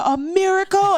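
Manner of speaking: very high, loud exclamation; retold joyful scream. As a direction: cry it out in overjoyed disbelief. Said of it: "I just started screaming".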